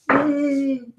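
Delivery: high-pitched turtle "mmm"; high held turtle hum; turtle mouth noise. Pretend. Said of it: "my big turtle mouth goes".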